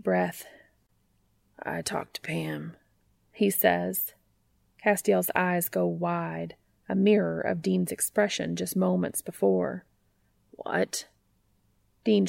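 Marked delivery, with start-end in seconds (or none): 1.6-2.8 hushed whispered confession. Acted. Dean confesses softly. 10.5-11.1 whisper. Acted. Stunned reply.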